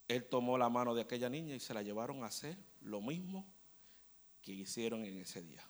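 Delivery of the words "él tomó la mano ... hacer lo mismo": ordinary talk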